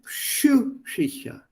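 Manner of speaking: "psychische" very slowly, in separated syllables; careful pronunciation. Shown as a demonstration. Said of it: "Two different pronunciations".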